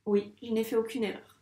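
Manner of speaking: normal talking voice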